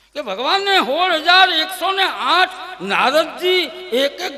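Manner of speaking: high, loud fervent sermon; full-force preaching. Real, not acted. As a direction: preach it out to the crowd.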